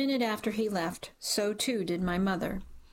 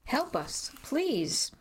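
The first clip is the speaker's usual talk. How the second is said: higher, pleading cry; desperate scream for help; 6 semitones higher, much slower than usual. A narrator's reading. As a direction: beg for help, voice climbing in panic. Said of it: "I screamed at Ricky to call for help".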